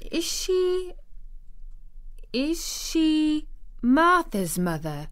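put-on high, halting little girl voice; timid stammer. A narrator's reading. Shy girl venturing a question to her guardian, stammering. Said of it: "she stammered".

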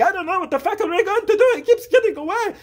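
high, loud fed-up rant; hammed-up frantic whine; mock exasperation. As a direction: plead at the top of your range. Frantic dad, brother wishing him gone.

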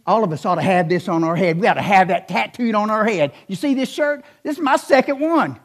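ordinary delivery

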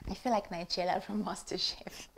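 soft playful whisper; a giggly whispered confession; whispered aside. Real, not acted. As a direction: breathe it to the camera like a secret. Whispered aside while cooking.

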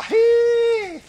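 high, loud hunter's holler; a big swamp holler urging the dogs on. Retold or imitated. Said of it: "old John Eubanks would holler".

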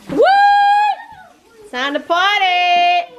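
high, loud, slow party cheer; party whoop. Real, not acted. A mom opening the family party with a loud high cheer. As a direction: hype up the room.

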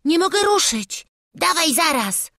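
high, loud urgent cry; desperate, high-pitched lad; frantic boy. A narrator's reading. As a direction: panicky child heaving a heavy load. Boys strain at the barrel.

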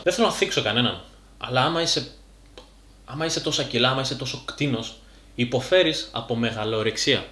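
normal talking voice

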